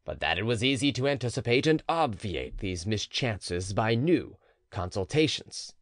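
usual speaking voice

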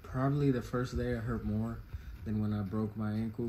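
ordinary talk, nothing marked

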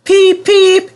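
very high, loud peeping; piercing two-note whistle imitation; engine whistle. A narrator's reading. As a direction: toot like a train whistle. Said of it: "he whistled".

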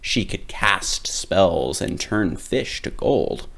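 usual speaking voice

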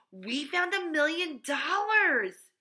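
loud, high, drawn-out cry of joy; ecstatic exclamation. A narrator's reading. Joy sees the bag stuffed with bills, ecstatic exclamation. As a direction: stretch it out high and loud, overjoyed.

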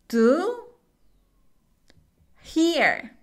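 slow, one word at a time with long pauses; teacher drilling each word slowly. Shown as a demonstration. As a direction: space out "I'm sorry to hear that" word by word.

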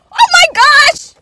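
high, loud, slow scream; terrified scream. Real, not acted. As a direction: let out a real scared scream. Spider flying, peak panic.

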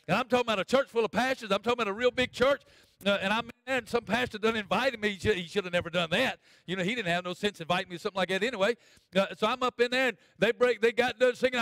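high, loud storytelling from the pulpit; preaching. Real, not acted. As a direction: build the tale up with preacher's energy. Animated preaching story at force.

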